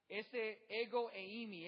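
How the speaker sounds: ordinary talk, nothing marked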